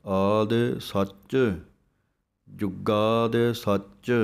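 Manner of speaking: ਸੱਚ said tight and firm, slowly; slow chant with a clipped ਸੱਚ. Shown as a demonstration. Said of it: "ਸੱਚ ਨੂੰ ਕੱਸ ਕੇ ਬੋਲਣਾ ਹੈ". (ਸੱਚ ਕੱਸ ਕੇ, ਹੌਲੀ ਹੌਲੀ)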